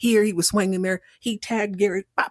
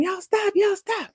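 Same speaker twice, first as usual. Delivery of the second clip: shouted "Y'all stop", pitched much higher; hollering; 12 semitones higher, slower than usual. Retold or imitated. Cricket hollering at the men brawling in the house. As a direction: holler at the two fighting men to quit. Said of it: "Cricket's hollering".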